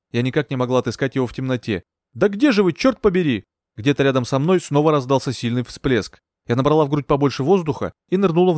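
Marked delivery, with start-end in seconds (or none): 2.1-3.4 high, frustrated searching cry; exasperated calling. A narrator's reading. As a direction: call out urgently into the dark. Searching for drowning man in dark.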